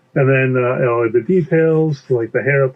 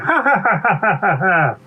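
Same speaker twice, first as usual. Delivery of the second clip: long acted ha-ha laugh; forced laughter; 6 semitones higher than usual. Bee laughs at pea's sense of humor, exaggerated laughter. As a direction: laugh it off in a descending run.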